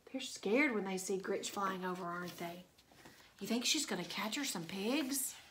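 plain reading voice

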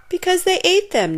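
high, loud wail of dismay. A narrator's reading. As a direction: wail it out in alarm. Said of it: "Nate wailed".

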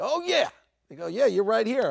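high, loud, animated welcome; eager greeting. Retold or imitated. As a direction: welcome an awaited guest loudly. Greeter acted out at the party.

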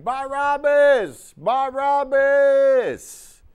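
very high, drawn-out crowd chant; crowd yelling. Acted. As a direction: chant the name like a mob. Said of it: "Everybody was yelling".

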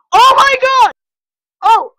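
very high, very loud gasping screams; terrified screams. Real, not acted. Jump-scared by a horrifying picture, shrieking screams. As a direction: shriek at the top of your lungs.

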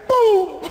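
high, loud boom of the hit; mock crash. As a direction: blurt the impact like a collision. Hit impact.

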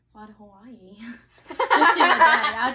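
laughing through the words; giggling while talking. Real, not acted. Laughing while describing Hawaii trip.

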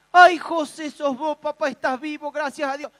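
high, loud, overjoyed cry of welcome; excited wife. Acted. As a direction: squeal with joy at a friend alive. Wife Marta greets José, overjoyed.

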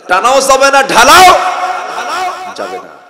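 high, loud forbidding; loud ban. Real, not acted. Preacher forbids smoking and drinking loudly. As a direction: slam the prohibition.

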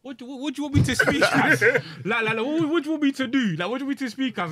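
loud, high-pitched exasperated outburst; indignant retort. Retold or imitated. Young actor answering a patronising compliment, loudly indignant. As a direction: blurt it out in loud, high disbelief.

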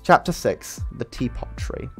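ordinary delivery, nothing marked

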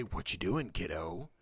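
masked bouncer voice, low and quiet; gruff guard voice. Acted. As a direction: loom over the kid in a deep voice.

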